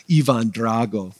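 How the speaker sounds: slow attempt at a Russian accent. Preacher naming Rocky's opponent, amateur Russian accent. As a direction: try the name in your best Russian accent. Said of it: "this is my best, uh, I think it was Russian, uh, accent".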